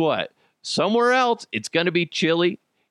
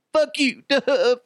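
much higher, laughing mock insult; mock Texan retort; 12 semitones higher, slower than usual. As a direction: play an offended Texan, half-laughing. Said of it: "everybody from Texas is like".

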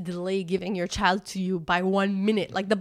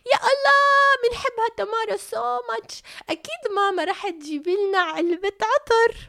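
much higher, sugary fawning voice; slimy principal; 13 semitones higher, 4 dB louder, faster than usual. Retold or imitated. Principal flattering the mom for a perfume gift, much higher. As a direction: gush over the child while fishing for perfume. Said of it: "she'd be like".

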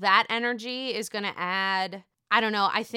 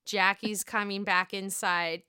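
ordinary talk, nothing marked

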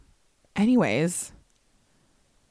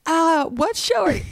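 confused surprise; 9 semitones higher, 3 dB louder than usual. Retold or imitated. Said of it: "And I'm like".

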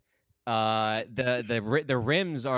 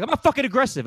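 high, loud indignant denial; pitched-up yell of protest; mock outraged shout; 11 semitones higher, 7 dB louder, faster than usual. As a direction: snap back as if insulted. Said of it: "I'm like".

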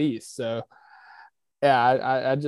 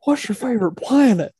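Harry Caray impression; 9 semitones higher, 8 dB louder than usual. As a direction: belt it like an old sportscaster. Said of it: "when he's like".

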